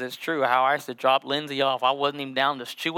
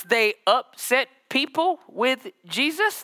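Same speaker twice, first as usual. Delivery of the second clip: higher, slower, climbing preacher cadence; building preaching; 6 semitones higher, slower than usual. Acted. Pastor builds the congregation up, higher and slower each line.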